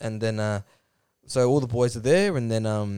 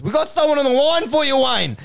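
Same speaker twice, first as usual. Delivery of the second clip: high, loud mock announcement; calling out; 10 semitones higher, 5 dB louder than usual. Producer telling Wayne about a caller, acted holler. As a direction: holler it across the garage.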